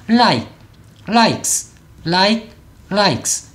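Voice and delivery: like and likes each as a single syllable; one-syllable likes. Shown as a demonstration. Teacher contrasts like and likes, both one syllable. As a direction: likes ends in a tight "ks", no vowel added. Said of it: "똑같이 이름절로 소리가 나야 되죠".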